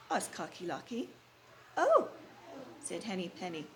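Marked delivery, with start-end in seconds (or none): 1.8-2.1 high, loud startled oh; surprised oh. Acted. Hen answering the rooster with a startled oh. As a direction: gasp it out in alarm. Henny Penny exclaims.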